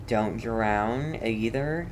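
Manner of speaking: ordinary talk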